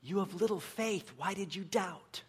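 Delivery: hushed, unhurried acted question; gentle reproof. Jesus gently reproves Peter.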